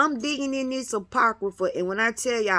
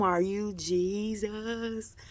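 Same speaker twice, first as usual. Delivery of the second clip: awed hush; 9 dB quieter, much slower than usual. Real, not acted. Awed slow question.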